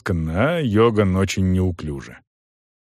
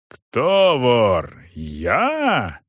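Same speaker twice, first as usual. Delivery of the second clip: yelling; much slower than usual. A narrator's reading. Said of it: "заорал сержант".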